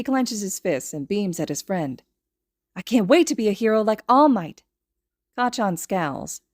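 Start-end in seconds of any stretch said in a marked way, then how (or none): 2.8-4.6 put-on higher, louder hopeful child voice; eager dream. A narrator's reading. Little boy eagerly tells his friend his dream. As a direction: beam as you say it.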